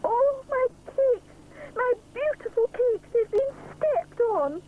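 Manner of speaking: hammed-up wail; moaning. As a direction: grieve over the ruined cakes. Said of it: "moans Mister Sponge".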